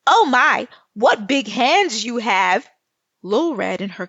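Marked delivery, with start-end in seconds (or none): 0.1-2.7 yelled tease. Acted. Lil Red yelling at the wolf about his big hands, louder. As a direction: yell it to poke fun at him. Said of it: "and she yelled".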